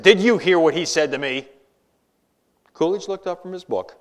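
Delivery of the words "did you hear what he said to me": loud, indignant senator demanding attention; angry senator. A narrator's reading. Offended senator confronting the vice president, loud and indignant. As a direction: storm up and confront the chair.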